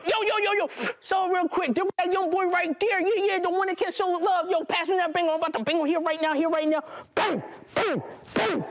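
high, hyped shooter voice; drive-by gunman. Retold or imitated. Shooter in the drive-by firing at the narrator, high hyped yell.

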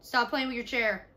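real mid-task telling-off; stern parental scolding. Real, not acted. Mother scolds child off camera.

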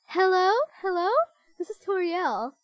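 ordinary talk

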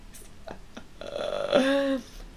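surprised laugh. Real, not acted. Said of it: "you just caught me by surprise".

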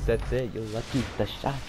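ordinary talk, nothing marked

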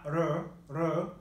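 "R" as a quick tap like a D; Spanish tapped R. Shown as a demonstration. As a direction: isolate the "R" as a tapped "ruh". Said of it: "is the Spanish R".

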